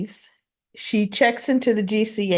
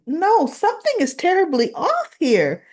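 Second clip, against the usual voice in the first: Tracy's alarm; 9 semitones higher than usual. Retold or imitated. Tracy alarmed, voiced in story.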